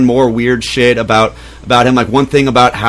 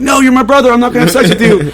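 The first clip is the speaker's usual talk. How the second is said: squeaky, flustered no; high flustered refusal; 10 semitones higher, faster than usual. Retold or imitated. Brother refusing his sibling, high and flustered. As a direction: go high and panicky.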